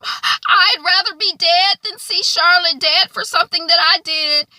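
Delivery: put-on high, loud anguished girl; hammed-up tearful outburst; Lucy quote. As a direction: pitch it way up as a desperate young girl. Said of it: "she's like".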